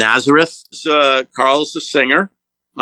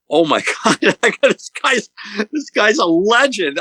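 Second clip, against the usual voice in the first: pitched way up, a laugh breaking through; starstruck excitement; 10 semitones higher than usual. Retold or imitated. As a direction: squeal it like a starstruck fan. Starstruck realizing who's calling.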